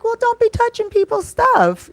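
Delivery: very high, sassy scolding tone; sassy high-pitched rebuke. Retold or imitated. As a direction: wag a finger with a high teasing scold. Narrator scolds the woman.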